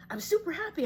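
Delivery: high, loud burst of happiness; super happy. Acted. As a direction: act out riding a happy high. Acts out riding the high wave.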